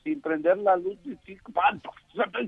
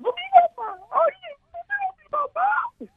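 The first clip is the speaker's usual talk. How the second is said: much higher, slow falsetto wail; high woman's voice; 4 dB louder, slower than usual. Acted. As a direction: stretch it out high and pleading.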